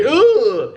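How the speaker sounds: high, loud grossed-out "ugh"; mock recoiling groan. People shown the finger, imitated disgusted groan.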